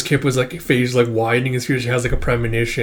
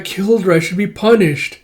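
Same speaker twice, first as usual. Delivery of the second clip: high-pitched guilty character voice; acted anguish, pitched up; distressed confession; 7 semitones higher, 4 dB louder than usual. Voicing a guilt-stricken character's confession.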